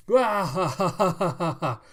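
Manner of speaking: big high staged belly laugh; loud mocking guffaw. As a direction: burst out in hammed-up laughter. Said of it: "that's enough laughing".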